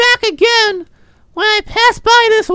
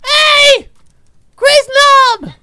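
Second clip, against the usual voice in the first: put-on high, loud drunken call; 6 semitones higher, 6 dB louder, slower than usual. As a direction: holler across the bar. Calling out to the bartender.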